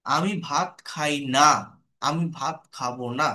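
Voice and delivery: ordinary talk, nothing marked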